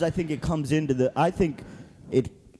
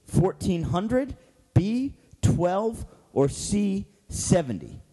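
quiz host; 4 semitones higher, slower than usual. Acted.

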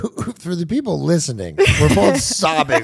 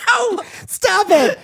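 very high, drawn-out squeal through laughter; real cracked-up, slow squealed objection; laughing protest; much slower than usual. Real, not acted. Protesting co-host's joke, laughing high squeal.

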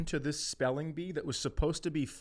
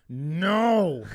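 put-on loud, higher teen refusal; sharp no; 4 semitones higher, 7 dB louder than usual. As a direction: snap it at your embarrassing dad. Daughter refusing dad's help.

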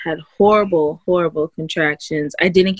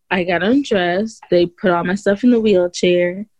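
usual speaking voice

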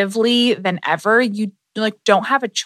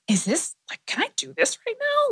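self-doubt; 9 semitones higher than usual. Retold or imitated. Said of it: "And then I'm like".

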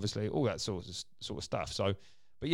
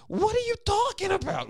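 much higher, loud cartoon Woody voice; Woody impression; 6 dB louder than usual. Dad imitating the Toy Story cowboy's indignant line, high and loud. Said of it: "the Woody character ... he says".

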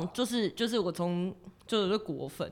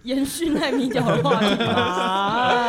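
slow, louder, through laughter; laughing; 3 semitones higher, 8 dB louder, much slower than usual. Real, not acted. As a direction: drag it out while laughing. Teasing follow-up joke.

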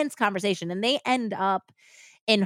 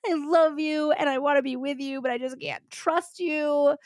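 mock-crying, high-pitched plea; tearful pleading; 7 semitones higher than usual. Woman working her suspect for a confession, tearful pleading. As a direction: plead as if through tears, voice raised high. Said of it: "she's, like, crying".

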